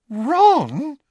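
loud, very high disbelief; astonished. A narrator's reading. Hermione learning Ron is prefect, astonished. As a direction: blurt the name in astonishment. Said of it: "her jaw dropping".

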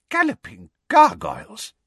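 high startled exclamation; shocked, high-pitched outburst; Fudge, shocked. A narrator's reading. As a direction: blurt it out in alarm. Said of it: "Fudge leapt backwards in shock".

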